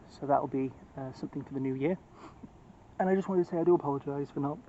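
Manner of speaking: ordinary delivery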